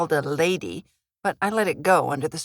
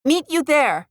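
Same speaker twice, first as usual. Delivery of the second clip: put-on higher, lighter teen girl voice; daughter's voice; 9 semitones higher, 4 dB louder than usual. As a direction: voice the daughter up high and loud. Daughter answers from other car.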